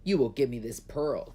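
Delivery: loud, forceful demand. A narrator's reading. Emperor demanding the dragon's pearl, demanding. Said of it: "demanded the emperor".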